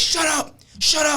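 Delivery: yelling cop. Retold or imitated. Cop silencing the pleading man, high mock yell. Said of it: "You're yelling at me".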